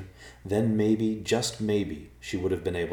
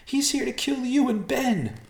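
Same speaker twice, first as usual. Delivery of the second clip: very high, desperate warning; high-pitched, distraught outcry; crying out; 4 dB louder than usual. A narrator's reading. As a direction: cry out the warning in panic. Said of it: "she cried".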